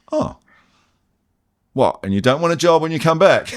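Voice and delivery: mock boss surprise. Boss baffled that his welder is quitting, mock-incredulous. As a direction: play the boss, baffled he wants to quit.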